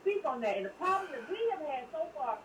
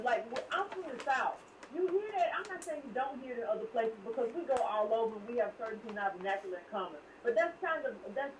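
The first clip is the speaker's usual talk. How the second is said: normal talking voice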